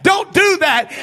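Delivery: warning prophet's voice. Acted. The man's voice warning the people, loud urgent shout. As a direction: cry out like a man sounding the alarm. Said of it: "the voice of a man that's saying".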